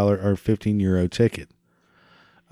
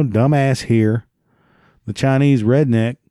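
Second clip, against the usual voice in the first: ordinary talk, nothing marked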